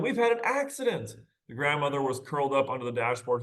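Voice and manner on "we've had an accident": put-on much higher, childlike cry; kids' high voice. Kids in the wrecked car announcing the crash, high childlike. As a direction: squeak it like an excited child.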